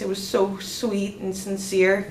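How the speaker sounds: ordinary talk, nothing marked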